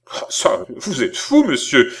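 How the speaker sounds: high, loud exclamation of disbelief; exclaiming. Acted. Balsamo reaches for the bell, exclaiming in exasperation. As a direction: cry out in exasperation. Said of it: "s'écria Balsamo".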